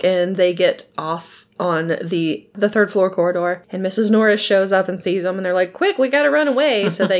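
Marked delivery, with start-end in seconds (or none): 5.7-6.9 quick, higher mock-urgent quote; retold urgency. Friends fleeing Missus Norris in a retelling, mock urgency. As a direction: hurry the words as if fleeing.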